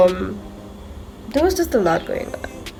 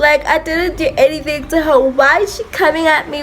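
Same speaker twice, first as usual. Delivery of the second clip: higher, pleading crying voice; retold crying; 6 semitones higher than usual. To friend after the fight, tearfully pleading. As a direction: replay the tearful confusion after being hit. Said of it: "I'm out there crying".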